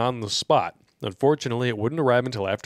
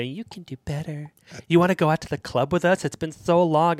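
higher, breezy friend voice; gossiping friends voice; 5 semitones higher than usual. Retold or imitated. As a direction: coax her out to the club. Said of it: "friends who are all going to say".

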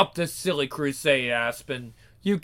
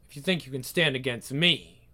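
usual speaking voice

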